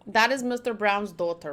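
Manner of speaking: normal talking voice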